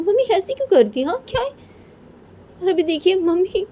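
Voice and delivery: high, whiny daughter voice; complaining daughter. Acted. Daughter complains to father about mother. (शिकायत करती बेटी)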